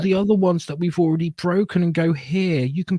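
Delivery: high, exaggerated mock sympathy; sing-song fake pity, talking down; patronizing. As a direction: imitate someone condescending to poor little children. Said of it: "They're being patronizing to them and going".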